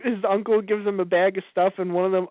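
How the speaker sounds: usual speaking voice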